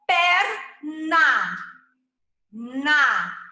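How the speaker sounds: "perna" loud and split, then "Na" alone; final syllable isolated. Shown as a demonstration. Teacher cues the rhyme, "perna" in syllables, then "Na" separately. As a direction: break "perna" in two and repeat its ending "Na". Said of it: "Tem que terminar com na".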